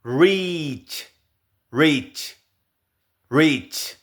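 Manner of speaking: reach ending in a crisp ch; final ch. Shown as a demonstration. Teacher modelling reach with a final ch. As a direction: drill reach, sharp ch at the end. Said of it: "la che al final puede ser".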